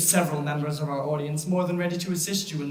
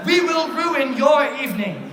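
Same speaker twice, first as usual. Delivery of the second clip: laughing shout; 7 semitones higher, 5 dB louder than usual. Acted. Threatening the audience jokingly.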